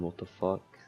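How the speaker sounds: usual speaking voice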